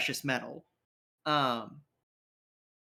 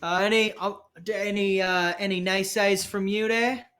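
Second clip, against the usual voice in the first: higher-pitched, jovial challenge; cocky crew member; 5 semitones higher than usual. Acted. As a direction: toss out a teasing challenge to the crew. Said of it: "and goes".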